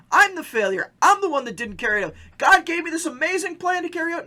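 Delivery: self-blaming outburst. Pretend. Pastor voicing a believer who blames himself, loud.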